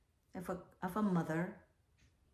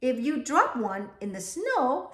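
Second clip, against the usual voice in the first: warning grandmother; 3 semitones higher, 7 dB louder than usual. Acted. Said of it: "she warned".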